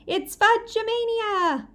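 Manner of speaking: high, slow, loud shout. A narrator's reading. Mitzi racing across the yard, high drawn-out shout. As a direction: race off yelling it.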